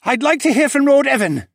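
loud, high creature yell. Acted. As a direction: call out across the courtyard. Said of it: "Cadahy yelled".

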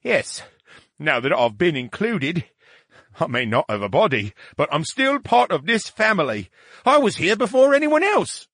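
high, proud and huffy; high brain voice. Acted. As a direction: insist on your place. Brain voice, mollified but proud.

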